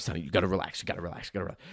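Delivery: low, soft repeated plea; hushed soothing. Retold or imitated. Dad to his squirming son on the ride, hushed coaxing. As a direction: drop low and quiet, as if calming a child.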